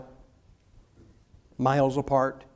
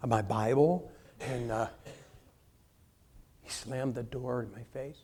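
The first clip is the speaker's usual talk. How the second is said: much softer, lower, embarrassed mumble; sheepish mumble; 4 semitones lower, 8 dB quieter than usual. Acted. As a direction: shrink into a timid confession.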